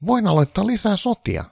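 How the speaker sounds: a needy caricature of Trump; high Trump voice. Acted. Trump begging Santa for good news, high-pitched.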